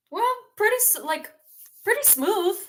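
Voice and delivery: lightly acted, high-pitched reply; high, joking comeback, retold; playful retold answer.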